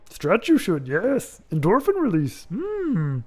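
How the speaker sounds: playful Yoda-style voice; Yoda-like impression. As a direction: imitate Yoda, pitch jumping on the hums. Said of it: "that is what he would say".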